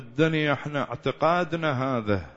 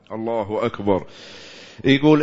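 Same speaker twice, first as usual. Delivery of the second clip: usual speaking voice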